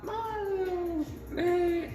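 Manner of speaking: high, slow cartoon Mario impression, far above his own voice. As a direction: swap to a squeaky video-game plumber voice, drawn out. Playing Mario voice in intro.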